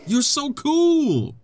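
high, drawn-out adoring squeal; gushing. Real, not acted. Player to his newly evolved Pokemon, gushing. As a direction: gush slowly in a high, delighted voice.

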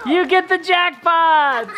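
excited announcement. Real, not acted. Announcing a winner.

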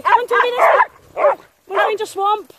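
high, loud call to the dog. Real, not acted. Owner calling Toby over to the swamp, high and loud. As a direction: sing out to get his attention.

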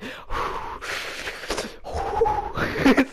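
high, breathy burst of laughter; shrieking laugh. Real, not acted. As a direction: gamer cracks up at his own joke about the character panting, with a high shrieking laugh. Laughing at own joke.

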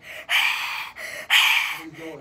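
huffing voiceless mock sobs; breathy fake sobbing. Shown as a demonstration. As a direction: sob in big airy heaves. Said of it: "I cry like".

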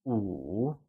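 Wu dipping low, then rising again; falling rising tone. Shown as a demonstration. Teacher modelling Wu with a low dipping tone for learners. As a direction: let Wu sink and curl back up. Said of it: "said with a falling rising tone".

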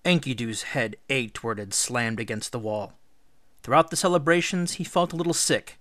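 usual speaking voice

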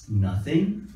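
NOTHING as a hesitant question; unsure, questioning tune on NOTHING. Acted. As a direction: answer NOTHING tentatively, as if asking. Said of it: "It's more like a question".

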